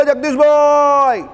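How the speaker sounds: long, drawn-out call pitched high; calling up from the street. Retold or imitated. Man on the street calls up to Jagdishbhai, long high drawn-out call. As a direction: call the name up to the fourth floor, stretching it slowly. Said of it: "રોજ ઉપર બૂમ પાડને કહો".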